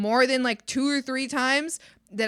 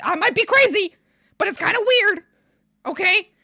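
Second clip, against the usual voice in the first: raised exasperated voice; 7 semitones higher, 6 dB louder than usual. Real, not acted. Scolding chat about boob jokes.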